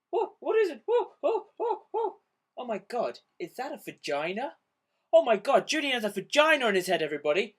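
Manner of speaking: high, teasing mock-alarm voice rising to a shriek; acted prank dialogue. Friend teasing Julian about his head, mock shriek. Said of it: "here is a transcript of what used to go on between us".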